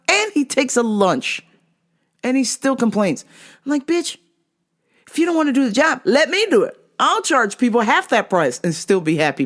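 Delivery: high-pitched, incredulous rant; indignant. Real, not acted. Coworker ranting about his short hours, indignant.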